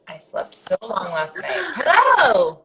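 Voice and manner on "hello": excited greeting. Real, not acted. Greeting guest joining live.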